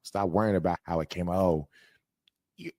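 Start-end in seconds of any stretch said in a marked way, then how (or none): 1.4-2.7 higher, halting wounded whine; mock hurt complainer. Mimicking people upset at his delivery, mock hurt. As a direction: act out an oversensitive listener, laughing a little. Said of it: "They sitting here talking about the tone".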